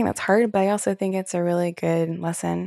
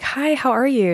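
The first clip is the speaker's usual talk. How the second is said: therapist's greeting; 8 semitones higher, 4 dB louder than usual. Retold or imitated. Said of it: "she'll call and be like".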